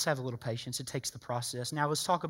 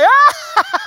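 high, loud mock-proud voice with a laugh; smug boaster; 17 dB louder, much slower than usual. Preacher mocking a proud harvester to his church. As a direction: ham up the smug harvester who did nothing.

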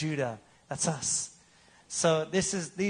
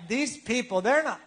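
higher, whiny imitation of a frustrated churchgoer; mocking complainer; 5 semitones higher than usual. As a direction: mimic their grumbling, pitched up. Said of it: "'Cause you're like".

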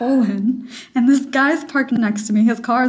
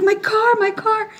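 retold scream; 10 semitones higher than usual. Driver re-enacts running after the rolling car, mock screaming. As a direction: re-enact a panicked scream at a higher pitch. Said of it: "and I'm screaming".

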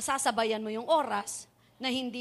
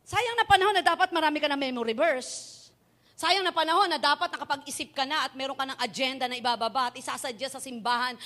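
forceful preaching; 3 semitones higher, 8 dB louder than usual. Acted. Preaching climax.

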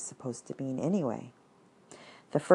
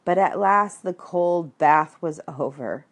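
ordinary delivery, nothing marked

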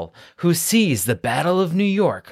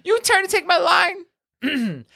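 mock offended squeak. Mock indignant high voice.

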